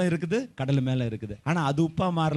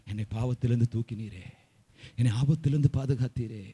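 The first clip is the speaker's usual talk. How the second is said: soft, lowered prayer of gratitude; tearful thanksgiving; 4 semitones lower, 5 dB quieter than usual. Shown as a demonstration. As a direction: demonstrate quietly pleading thanks to God. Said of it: "எப்படி அழுவேன் தெரியுமா?". (கண்ணீரோடு நன்றி சொல்லும் மென்மையான ஜெபம்)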